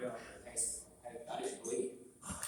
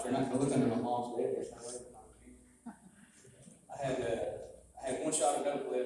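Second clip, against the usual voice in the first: usual speaking voice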